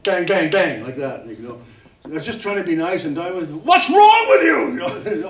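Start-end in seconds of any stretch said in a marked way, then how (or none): 3.5-4.7 high, loud, slow exasperated outburst; exasperated snap. Retold or imitated. Husband flaring at his wife mid-argument, loud and high. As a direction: throw the question at your wife in frustration.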